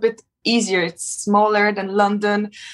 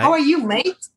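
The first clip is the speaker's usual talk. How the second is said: a playful, higher try at an Australian accent; attempted Australian accent; 7 semitones higher than usual. Shown as a demonstration. Learning Australian from the host, a playful mock Australian accent. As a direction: copy the host's Australian "mate". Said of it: "you just need to nail Australian".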